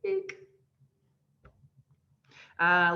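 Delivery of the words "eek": high squeak. Acted. Mocking codependent line.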